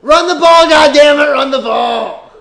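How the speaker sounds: loud fan yell at the screen. Real, not acted. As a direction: demand it from the team, shouting. Yelling at the TV play.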